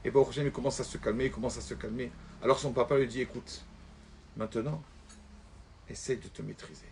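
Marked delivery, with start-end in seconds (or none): 3.2-6.9 soft fatherly advice. Acted. Father softly advises son.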